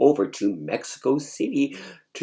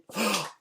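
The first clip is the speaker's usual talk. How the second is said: shocked gasp; 10 semitones higher, 5 dB quieter than usual. Retold or imitated. Hearing shocking news.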